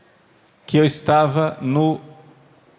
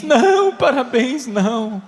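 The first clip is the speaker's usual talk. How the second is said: a jump up into a high protesting woman's voice; a mock woman, high and protesting; 6 semitones higher than usual. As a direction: play the dismayed young wife, pitched high. Woman rejects congratulations.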